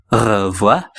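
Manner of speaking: "Revoir" slowly in two parts, "ruh... vwah"; "Revoir" stretched out for learners; broken-down word. Shown as a demonstration. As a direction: draw out "Revoir" syllable by syllable. Teacher breaks down the word.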